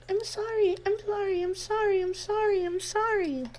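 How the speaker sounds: high, soft, pain-twisted repeats; like a broken record, hurting; pained apologizing. Acted. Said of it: "twisted with pain".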